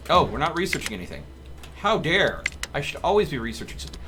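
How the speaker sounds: ordinary talk, nothing marked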